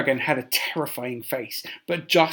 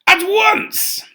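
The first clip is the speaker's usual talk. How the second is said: loud, high imperious order; commanding; 9 semitones higher, 9 dB louder than usual. Acted. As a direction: bark the order down at him. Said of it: "the Emperor snapped".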